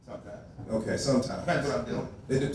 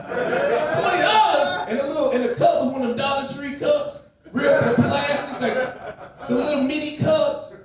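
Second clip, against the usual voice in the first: outraged laughing rant; 6 semitones higher than usual. Real, not acted. Ranting about overpriced drinks.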